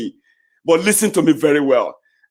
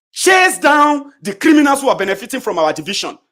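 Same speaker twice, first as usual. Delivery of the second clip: shouting it out, pitch up high; rising furious rant; angry shout; 8 semitones higher, 4 dB louder than usual. Real, not acted. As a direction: hammer the criminals line hard.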